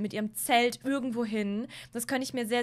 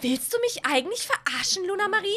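high, louder furious accusation; angry sister; 7 semitones higher, 3 dB louder than usual. Acted. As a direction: confront your sister, furious. Sisters' acted quarrel.

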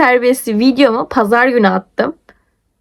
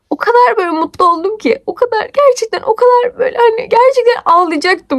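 overjoyed; 8 semitones higher than usual. Retold or imitated. Relief at being helped.

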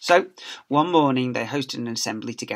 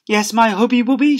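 high, louder sugary voice; doting wife; 9 semitones higher, 8 dB louder than usual. Acted. Wife cooing to her husband, high sugary voice. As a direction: coo at your husband. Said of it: "she would ask".

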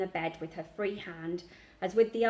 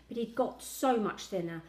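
ordinary delivery

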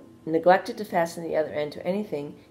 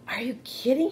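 higher, startled little pig voice; surprised pig; 8 semitones higher than usual. A narrator's reading. Wilbur asking Charlotte about her legs, high surprised voice. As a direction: Wilbur gasping in disbelief.

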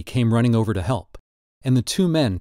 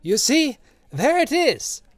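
crying out; 14 semitones higher, slower than usual. A narrator's reading. Old man showing his back to the crowd, high cry. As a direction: throw out the word high. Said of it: "he cried, breathing quickly".

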